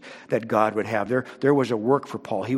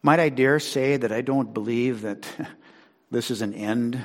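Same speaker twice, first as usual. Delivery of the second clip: usual speaking voice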